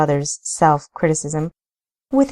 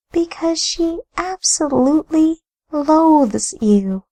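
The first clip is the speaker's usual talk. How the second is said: higher, grave woman's voice; Amelia's voice; 6 semitones higher than usual. Acted. Amelia warning her brother-in-law, grave and higher. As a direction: play her grave and firm.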